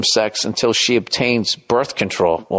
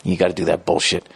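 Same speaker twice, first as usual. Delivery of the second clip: ordinary talk, nothing marked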